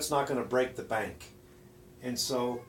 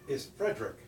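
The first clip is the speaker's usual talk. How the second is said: ordinary talk, nothing marked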